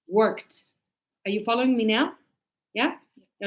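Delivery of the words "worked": ordinary talk, nothing marked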